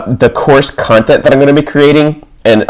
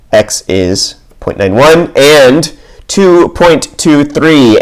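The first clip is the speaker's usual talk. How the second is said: ordinary talk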